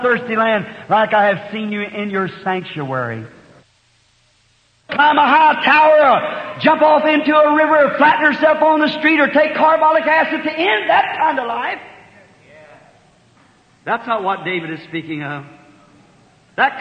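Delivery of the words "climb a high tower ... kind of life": loud rising preacher's cry; preaching climax. Acted. Preacher warning the congregation, loud and driving. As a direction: hammer out the list at full force.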